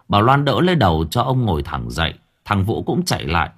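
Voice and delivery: ordinary delivery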